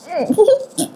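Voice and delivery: high, muffled bursts of held-in laughter; imitated stifled laughter. Daughter covering the receiver while listening, imitated stifled laughter. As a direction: try to choke back a laugh. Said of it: "터져 나오는 웃음을 어떻게 가-, 막을 수가 없으니까".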